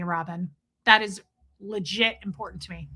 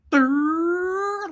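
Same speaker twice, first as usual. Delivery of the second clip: silly lip trill; 7 semitones higher, 3 dB louder than usual. Retold or imitated. Silly noise after quote.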